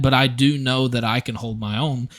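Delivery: ordinary talk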